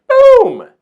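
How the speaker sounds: very high, loud boom; big emphatic boom; dramatic boom. Real, not acted. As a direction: land the switch hard. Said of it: "dramatic switch flip".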